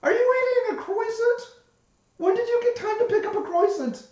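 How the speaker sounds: high, loud incredulous squeak; surprised. Acted. Surprised at the croissant.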